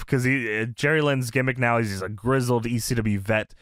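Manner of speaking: ordinary talk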